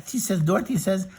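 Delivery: usual speaking voice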